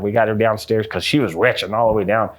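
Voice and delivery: plain reading voice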